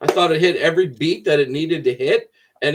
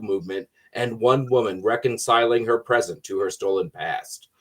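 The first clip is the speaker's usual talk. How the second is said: announcer voice; 4 semitones lower than usual. Acted. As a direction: narrate it like a trailer announcer. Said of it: "I also don't have Jason announcer voice".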